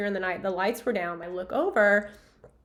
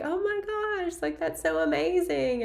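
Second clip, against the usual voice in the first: much higher, delighted exclamation; excited gushing; 8 semitones higher than usual. Retold or imitated. Fan congratulates [name]'s mom, high delighted gush. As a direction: burst out in delight. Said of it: "just being like".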